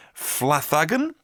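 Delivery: Flathagen said as FLATH-AG-en, short a in the middle, rising; tentative name attempt. Shown as a demonstration. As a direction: slowly try Flathagen as "flath-AG-en", questioning. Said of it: "I hope one of those is correct".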